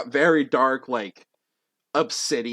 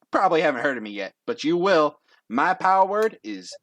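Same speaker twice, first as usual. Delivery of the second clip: raised, cocky patter; all confident; 6 semitones higher, 4 dB louder than usual. Acted. Confident Dex to unimpressed judges, cocky pitch. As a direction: boast it with a showman's confidence. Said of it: "all confident".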